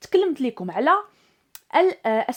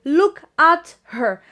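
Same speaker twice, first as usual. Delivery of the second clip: very slow and louder; slow model phrase; 2 semitones higher, 6 dB louder, much slower than usual. Shown as a demonstration. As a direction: stretch out "look at her" word by word. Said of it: "صافي، إذن".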